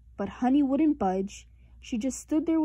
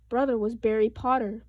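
plain reading voice

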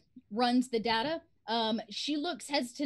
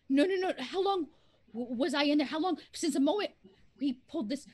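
mild panic; 4 semitones higher than usual. Acted. Woman panics about lost time.

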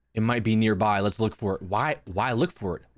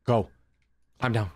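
ordinary talk

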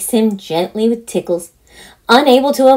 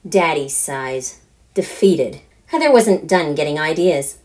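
plain reading voice

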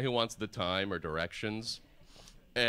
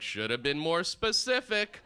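mock exasperated punchline; 7 semitones higher, 5 dB louder than usual. His punchline reaction to closing store.

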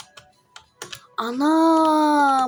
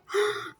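sharp high gasp; surprised gasp; 8 semitones higher, 6 dB quieter than usual. Real, not acted. Discovers bargaining option. (şaşkınlıkla nefesi kesilerek)

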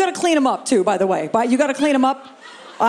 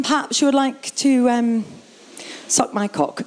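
polite mock British accent; imitation of a well-mannered British guy; slower than usual. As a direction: put on a courteous British accent, hesitating mid-sentence. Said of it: "a guy with a British accent".

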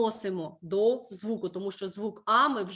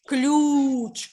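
ключ with its У sound held long and loud; clear stressed vowel; 3 semitones higher, 6 dB louder than usual. Shown as a demonstration. Teacher gives pupils another stress example, holding the У in ключ loud and long. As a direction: stretch the vowel of ключ strongly. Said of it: "звук У чітко відчуватимемо".

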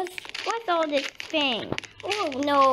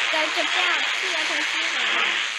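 ordinary delivery

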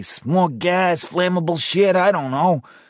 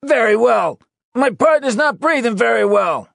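loud, high correcting call; shouted; 5 dB louder than usual. Acted. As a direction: yell the correction out. Still shouting out to rescuers.

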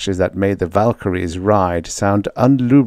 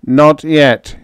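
"not yet" high and sharp; warning; 7 semitones higher, 5 dB louder than usual. Acted. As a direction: stop them with a warning. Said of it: "he warned".

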